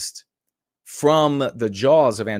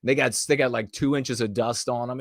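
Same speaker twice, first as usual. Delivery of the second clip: ordinary talk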